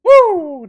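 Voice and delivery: high loud woo; frat bro woo. Real, not acted. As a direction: whoop in celebration. Celebration cheer.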